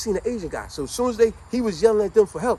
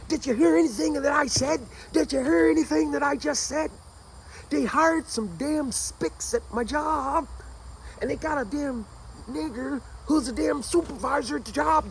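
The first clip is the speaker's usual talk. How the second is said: indignant bigot rant; 10 semitones higher than usual. Retold or imitated. Bigot insists loudly to friends.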